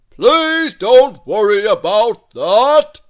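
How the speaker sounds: very loud, slow bellow; noisy giant voice; booming Mister Noisy. Acted. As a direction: shout it cheerfully slow.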